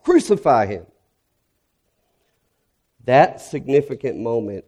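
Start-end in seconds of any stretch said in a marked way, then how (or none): 0.0-0.8 mock crowd shout. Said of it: "shake their fists and shout".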